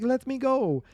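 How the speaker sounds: high-pitched imitation of a struggling girl; mock pleading captive.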